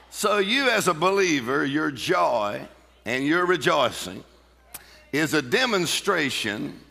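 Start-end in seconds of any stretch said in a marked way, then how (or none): none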